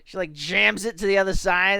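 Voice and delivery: usual speaking voice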